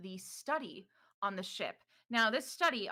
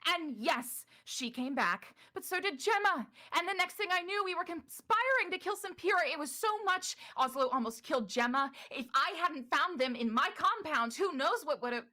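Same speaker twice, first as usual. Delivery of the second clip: high and louder, rushing defensively; Ystera's heated account; 9 semitones higher, 3 dB louder than usual. Acted. Ystera interrupting Morrow to justify herself, high and defensive. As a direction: pile up the grievances. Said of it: "And she says".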